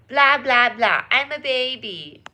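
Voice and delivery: slow put-on baby voice. Streamer joking to chat in a slow baby voice. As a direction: play a slow little baby. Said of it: "baby voice".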